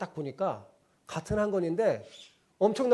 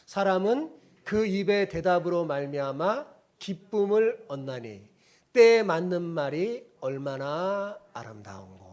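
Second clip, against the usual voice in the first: louder, measured recitation of the verse; scripture reading; 6 dB louder than usual. Acted. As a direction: read it aloud with the congregation. Leads congregation reading scripture. (회중과 함께 성경을 낭독하는 목사)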